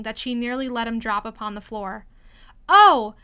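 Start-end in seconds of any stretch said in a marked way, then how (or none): 2.7-3.1 loud, slow, drawn-out plea; crying out. A narrator's reading. Alice pleading with the dusted King, crying out. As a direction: exclaim it, stretching the first words. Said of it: "she cried out".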